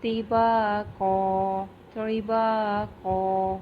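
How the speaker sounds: طباقا drawn slowly; slow tajweed. Shown as a demonstration. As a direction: recite طباقا stretched. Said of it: "اخفا کا رول".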